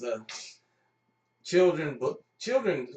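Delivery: ordinary delivery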